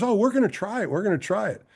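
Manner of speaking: lightly voiced, high and easygoing; breezy go-along. Vida casually agreeing to try TV, high and breezy. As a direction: shrug it off, upbeat. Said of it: "And he goes".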